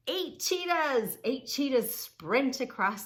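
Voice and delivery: high, loud title call; eager announcing. Shown as a demonstration. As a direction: announce the animals with excitement. Announcing next page.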